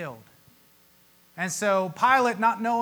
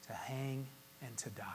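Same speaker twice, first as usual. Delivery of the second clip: hushed whisper; 6 semitones lower, 17 dB quieter than usual. Real, not acted. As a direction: breathe the words, barely voiced. Climax of crucifixion account.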